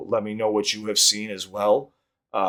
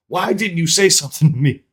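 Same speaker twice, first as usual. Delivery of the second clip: imitated fiery complaint, raised voice; a little fiery; 8 semitones higher, 6 dB louder than usual. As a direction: mimic a girlfriend's louder, higher reproach. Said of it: "so she's a little fiery".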